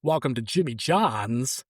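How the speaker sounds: slow, oddly paced Walken-style phone greeting; lightly put-on Walken voice, slow and halting; Christopher Walken impression. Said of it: "Answer the phone, it's Christopher Walken".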